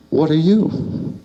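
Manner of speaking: high, drawn-out wondering question. Acted. Mouse to a big brown hairy thing, wondering. Mouse meets strange creature.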